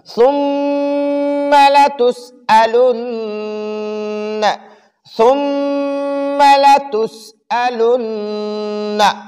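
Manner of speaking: slow, drawn-out tajweed chanting; Quran recitation. Shown as a demonstration. Teacher recites verse for students, slow chant. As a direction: recite it melodically for the class.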